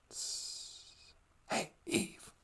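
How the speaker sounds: whispered snake hiss, soft and unvoiced. Snake hissing to lure Eve, whispered. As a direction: hiss her name like a sly snake.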